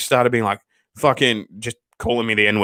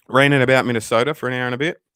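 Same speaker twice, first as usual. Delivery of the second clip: ordinary talk, nothing marked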